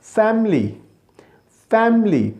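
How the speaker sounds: "फैमिली" as fam-lee, high then falling; correct pronunciation. Shown as a demonstration. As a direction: drill "फैमिली" in two syllables. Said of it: "आज से हमने क्या करना है".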